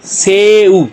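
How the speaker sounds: "से उ" drawn out, both vowels held; syllable by syllable. Shown as a demonstration. Teacher answering what it makes, "से उ" slowly sounded out. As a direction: stretch "से" into a separate "उ". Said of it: "तो क्या बन गया जी".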